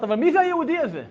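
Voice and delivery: high, loud puzzled question; acted bafflement. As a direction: ask in disbelief, voice climbing.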